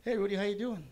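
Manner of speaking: perky-pitched therapist hello. Acted. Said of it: "That was my therapist".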